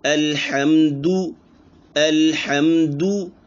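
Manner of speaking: slow chanted अल्हम्दु with full pesh; drawn out अल्हम्दु; full vowel recitation. Shown as a demonstration. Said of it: "पेश की मिसाल".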